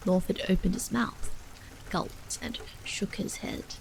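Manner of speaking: ordinary delivery, nothing marked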